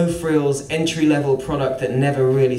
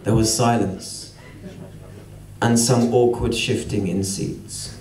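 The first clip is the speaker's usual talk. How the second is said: plain reading voice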